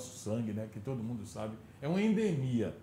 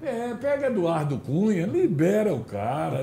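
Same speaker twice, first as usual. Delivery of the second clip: loud laughing mock plea; 4 semitones higher, 8 dB louder, slower than usual. Jokingly loud retelling with laugh.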